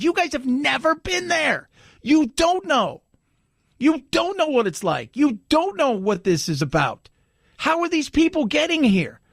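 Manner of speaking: high-pitched retold indignation; imitated mad. Mexican immigrant tells the table they've never been there, mock exasperation. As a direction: mimic a frustrated man setting people straight. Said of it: "he was mad because he had done it right".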